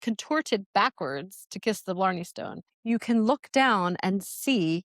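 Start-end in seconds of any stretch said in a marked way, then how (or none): none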